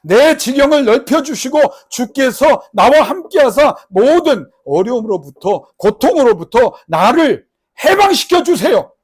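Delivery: slow, loud pleading cry to God; impassioned prayer. Acted. Preacher voicing Jabez begging God, loud and slow. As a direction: pray it out at full force.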